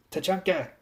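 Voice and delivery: put-on high German-accented call; German woman calling out, acted; heavy German accent. A narrator's reading. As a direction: call his name in a thick German accent. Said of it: "she said with a heavy German accent".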